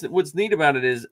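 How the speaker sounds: ordinary talk, nothing marked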